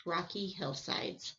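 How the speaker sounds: plain reading voice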